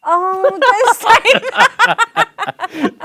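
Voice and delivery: high, loud laugh through drawn-out words; laughing protest. Real, not acted. Host reacts to guest's joke about his mum, laughing loudly. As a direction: protest in amused sympathy, breaking into laughter.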